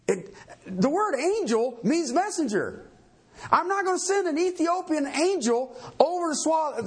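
ordinary talk, nothing marked

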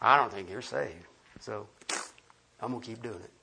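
mock dismissive retort. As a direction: shrug it off with quiet smugness. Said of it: "What'd you just do?".

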